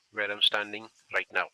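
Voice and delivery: loud voice carried across a road; projecting voice. Real, not acted. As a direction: project to the team on the far side.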